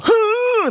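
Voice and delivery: high, sudden gasp of pain; imitated sharp gasp. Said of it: "make that noise while she".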